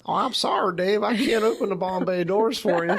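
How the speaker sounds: Hal the computer with a hammed-up Southern twang. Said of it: "Hal ... in this Southern accent".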